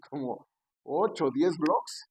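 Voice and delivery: ordinary talk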